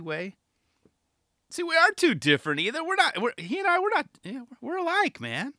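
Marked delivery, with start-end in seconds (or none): 1.5-5.5 much higher, rushed and giddy; high, fast excited voice. Acted. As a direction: gush it out quickly. Joking he is like Tyshawn.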